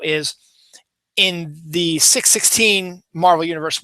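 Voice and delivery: ordinary talk, nothing marked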